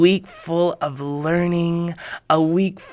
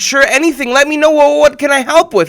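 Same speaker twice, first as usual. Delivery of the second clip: eager reply; 7 semitones higher, 5 dB louder, faster than usual. Retold or imitated.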